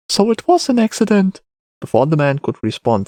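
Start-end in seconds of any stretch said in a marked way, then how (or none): 0.1-1.4 put-on high-pitched boy concluding; child character. A narrator's reading. Boy reasons about the killing, high child voice.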